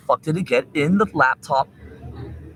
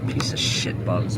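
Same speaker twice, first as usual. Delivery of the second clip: slow, breathy muttered insult; muttered curse; 8 semitones lower, 5 dB quieter, slower than usual. Real, not acted. Streamer grumbles at the bugs, muttered curse. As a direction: grumble it under your breath.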